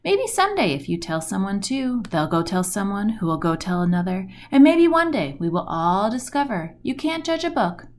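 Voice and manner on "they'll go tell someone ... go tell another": ordinary delivery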